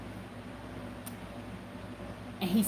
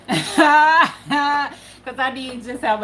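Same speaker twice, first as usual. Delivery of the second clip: slow, higher and louder through a laugh; 4 semitones higher, 6 dB louder, much slower than usual. Real, not acted. Mother laughing off a painful memory, slow and loud. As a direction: laugh it out slowly.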